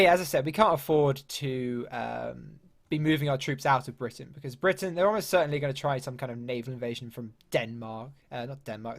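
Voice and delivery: ordinary talk, nothing marked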